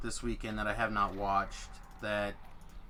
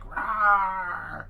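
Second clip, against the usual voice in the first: loud high roar again, just as big; dinosaur voice; 9 semitones higher, 10 dB louder than usual. Pretend. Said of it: "I'll still be like".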